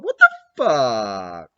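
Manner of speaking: slow fake crying wail; mock wail. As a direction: drag it out like mock weeping. Mock crying over hair.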